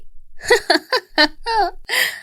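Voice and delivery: long high-pitched gloating cackle; villainous laugh. Acted. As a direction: cackle in triumph at your rival. Villain gloats over her scheme.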